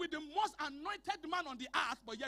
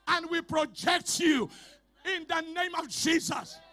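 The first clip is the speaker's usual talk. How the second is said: loud, shouted declaration; preaching at full force; 7 dB louder than usual. Acted. Pastor decreeing over the congregation, loud shouted preaching. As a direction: thunder the blessing over them.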